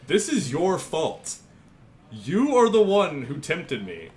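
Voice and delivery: loud, high, drawn-out blame; mock accusation. Streamer blames chat for tempting him, mock-accusing.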